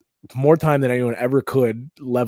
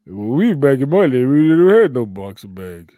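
put-on loud, slurred old boxer; Rocky; 7 dB louder than usual. Old boxer recalling his day, loud slurred impression. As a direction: bellow it through a punch-drunk mumble. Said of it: "That's actually not a bad Rocky".

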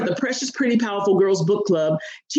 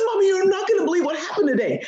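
put-on excited child; 9 semitones higher, faster than usual. Excited daughter running off bus.

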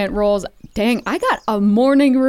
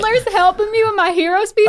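much higher, amazed question; surprised; 11 semitones higher than usual. Real, not acted. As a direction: sound delighted and surprised.

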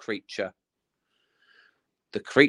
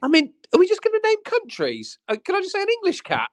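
exasperated; faster than usual. Real, not acted. Exasperated at the country names.

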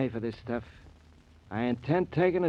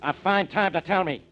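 irritated complaint, raised voice; annoyed; 5 semitones higher, 7 dB louder than usual. Acted. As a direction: complain loudly about the mud. Inspector splashed with mud.

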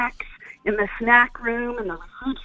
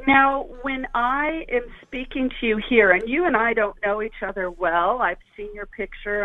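normal talking voice

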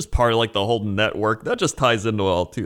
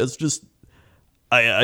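ordinary talk

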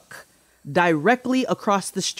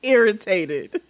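irritated; 10 semitones higher, 3 dB louder than usual. Real, not acted. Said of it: "I'm so irritated".

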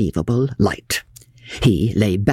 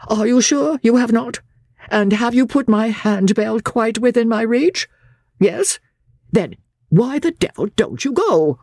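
high, querulous invalid's voice; peevish master; 13 semitones higher than usual. A narrator's reading. Master dismissing his valet, high and peevish. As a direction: fuss at the servant in a high whine. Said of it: "pointed peevishly to a chair".

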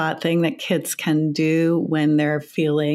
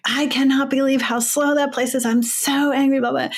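higher-pitched retold rant in the car; mock rant; 8 semitones higher than usual. Mother ranting in the car after the supermarket, mock frustrated rant. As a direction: replay her own frustrated ranting, half-mocking.

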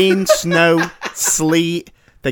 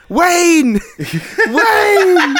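high, loud mock holler; re-enacted desperate yell, drawn high; retold shout; 5 dB louder than usual. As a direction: call out after a departing van, hammed up. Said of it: "and I'm shouting".

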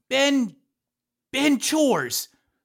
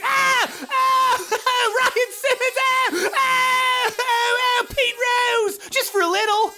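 high panicked crowd screams; panicked screaming; slower than usual. Retold or imitated. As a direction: scream like fleeing bystanders. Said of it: "People going".

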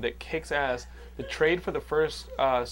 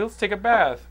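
mock sweet parent; 5 semitones higher, 5 dB louder than usual. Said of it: "I'd be like".

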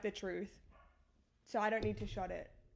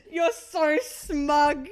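laughing; 3 semitones higher, 9 dB louder, much slower than usual. Real, not acted. Laughing at friend.

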